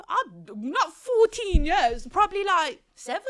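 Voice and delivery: high, loud and slow exclamation; slow high shout of disbelief; incredulous outburst. Real, not acted. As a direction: blurt it out in disbelief.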